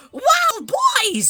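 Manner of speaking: acted burst of excitement on spotting something cute; thrilled little outburst; excited. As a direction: gush as if thrilled by the find. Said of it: "So I get excited".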